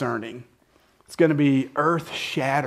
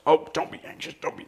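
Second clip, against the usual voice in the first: mock flippant dismissal; 3 semitones higher, faster than usual. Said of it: "we can't just will our way out of anxiousness".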